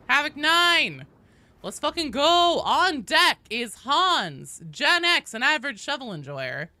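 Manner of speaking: loud, high lineup call; big stretched-out hype call; hyped announcement. Real, not acted. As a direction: announce the players like a ring announcer.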